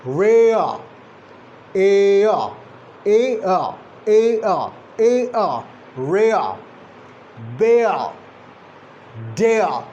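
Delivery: "ea" as a glide from "eh" to "uh"; isolated, deliberate "Rea", "bear", "dare" with no r; diphthong drill. Shown as a demonstration. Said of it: "gliding movement from one vocalic position".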